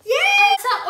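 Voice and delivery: high, loud happy call; excited call. Real, not acted. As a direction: call out in delight. Excited after the order goes through.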